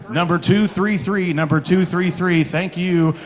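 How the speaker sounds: ordinary talk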